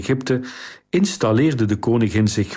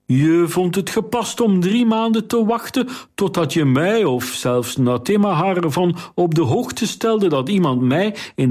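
higher, rising, interrogating queen; indignant queen; 6 semitones higher than usual. Acted. Queen cross-examining Kariline, climbing higher in disbelief. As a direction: press her with mounting disbelief.

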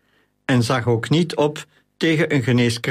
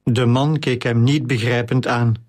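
usual speaking voice

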